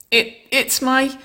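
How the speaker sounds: high, slow, embarrassed stammer; bashful, hesitant high voice; sheepish. A narrator's reading. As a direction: falter as if caught out. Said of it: "she grinned sheepishly".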